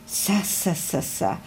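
soft, fading anxious sighs; shy dragon sighs nervously; anxious sighing. A narrator's reading. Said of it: "seufzte Krachu mit ängstlichem Augenaufschlag".

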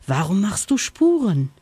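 plain reading voice

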